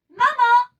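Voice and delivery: loud, high call to mama; child shouting for mama with joy; excited call. A narrator's reading. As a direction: call out thrilled. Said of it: "I called out with excitement".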